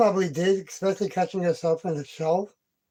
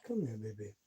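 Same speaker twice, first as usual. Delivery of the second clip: soft low coo; 10 semitones lower, 14 dB quieter, faster than usual. Real, not acted. Soft call to cat.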